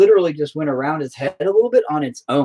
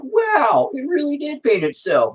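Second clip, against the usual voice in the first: much higher, gushing imitated voice; mock amazed viewer; 11 semitones higher, slower than usual. Painter imitating an amazed viewer, high-pitched gush. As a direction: gush like a convinced skeptic. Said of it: "they'll be like".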